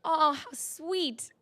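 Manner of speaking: slow, high, patronizing "how sweet"; a senior reporter mocks a novice, slow and sugary; condescending coo. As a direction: coo it slowly like talking to a child. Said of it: "and he said".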